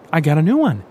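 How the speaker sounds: excited. Acted. Eyes lit up showing arm.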